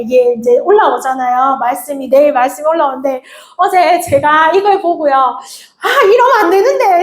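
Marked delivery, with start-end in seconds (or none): 5.1-7.0 높고 크게 터뜨리는 아쉬운 탄성; 어쩔 줄 몰라 외치듯; 탄식하듯 외침. Retold or imitated. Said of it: "이렇게 외쳤어요". (반쯤 장난스럽게 외치는 탄식)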